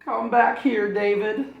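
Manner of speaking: high, loud call after a runaway; mother shouting after her son; mom calling out. Acted. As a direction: call him back down the street.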